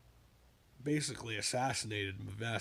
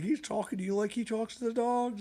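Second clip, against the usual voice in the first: pitched well up, teasing remark; mock him, voice high up; a friend teasing, voice pitched high; Jim's tease; 10 semitones higher, 3 dB louder than usual. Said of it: "Jim was like".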